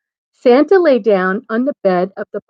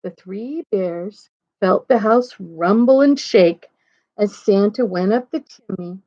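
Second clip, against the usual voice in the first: usual speaking voice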